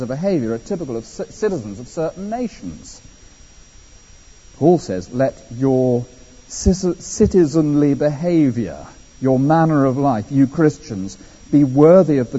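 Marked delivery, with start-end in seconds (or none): none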